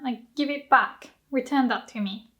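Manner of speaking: normal talking voice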